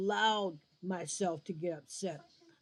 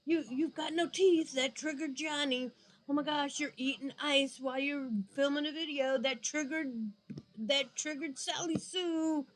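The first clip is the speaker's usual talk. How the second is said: mock babying; 6 semitones higher than usual. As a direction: tiptoe around little Johnny, sarcastic. Mocking coddling of sensitive viewers.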